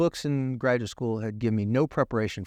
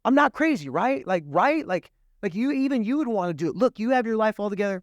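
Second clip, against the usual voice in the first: put-on girl pleading; 8 semitones higher than usual. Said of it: "she goes".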